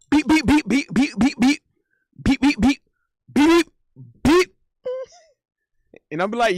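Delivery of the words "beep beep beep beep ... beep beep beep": high, loud "beep" flurry; rapid jabs of "beep", climbing louder and higher; hammed-up boxing sound effects; mimicked punch noises. Imitates girlfriend throwing punches.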